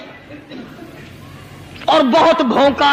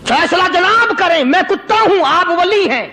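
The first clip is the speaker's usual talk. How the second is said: lightly voiced indignant dog, higher pitch; mildly acted, high-pitched rebuke; reproachful talking dog; 6 semitones higher than usual. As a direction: throw the accusation back at the saint. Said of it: "وہ یہ کہنے لگا کہ ولی صاحب".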